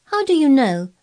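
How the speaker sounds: blunt, high challenging question; unsympathetic. A narrator's reading. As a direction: cut him off without pity. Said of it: "said Mary unsympathetically".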